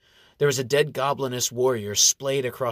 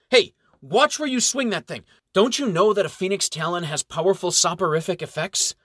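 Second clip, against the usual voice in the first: a sharp, much higher warning bark; 7 semitones higher, 4 dB louder than usual. A narrator's reading. Brother Umbert barks at the reckless young monk, higher and louder. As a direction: snap at him to watch the blade. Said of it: "Brother Umbert barks".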